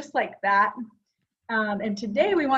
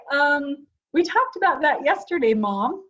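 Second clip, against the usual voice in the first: raised pitch and volume, re-enacting her own reply; lightly acted reminder, higher and louder; retold reply to mom; 5 semitones higher, 5 dB louder than usual. Daughter reminding mom of yesterday's talk, retold in a raised voice. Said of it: "And I'd say".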